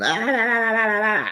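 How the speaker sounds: high, run-on "la la la" babble; bickering noise. Retold or imitated. Two castmates arguing back and forth, mimicked bickering. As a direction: mimic two people squabbling in high gibberish. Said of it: "go back and forth".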